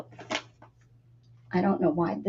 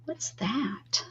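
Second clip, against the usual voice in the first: whispering; 11 dB quieter than usual. Real, not acted. Whispering while crafting.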